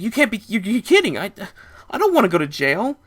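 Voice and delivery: high, loud stammering panic; panicked. Retold or imitated. Narrator panicking about jail, high and stammering. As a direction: stumble over the words, scared.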